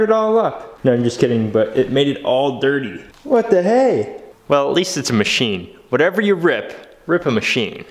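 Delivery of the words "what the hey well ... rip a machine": ordinary delivery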